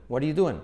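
"What are you doing?" in one breath as "wariu duen"; native linked pronunciation. Shown as a demonstration. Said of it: "se pasan a what are you".

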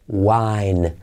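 "wine" with a light semivowel w, no g sound. Shown as a demonstration. Teacher showing students the semivowel w of "wine". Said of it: "no decimos wine".

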